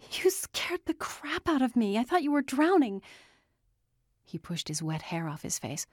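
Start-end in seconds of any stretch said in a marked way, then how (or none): 0.1-3.0 high, rattled outburst; alarmed scolding. A narrator's reading. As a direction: scold out of fright, rushing toward the end. Frightened woman scolds man after scare.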